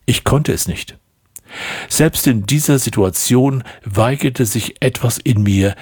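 plain reading voice

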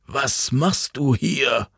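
put-on slow, worried old man's voice; a hint of a snapping old man; old man confronting Jan, slow and concerned. A narrator's reading. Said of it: "fuhr er Jan an".

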